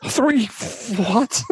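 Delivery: high incredulous what; like the number can't be real; shocked disbelief. Real, not acted. Co-host hears the film runs nearly four hours, shocked and high. Hearing the film's runtime.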